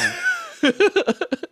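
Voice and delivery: squealing high laugh. Real, not acted. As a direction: burst into shrill laughter.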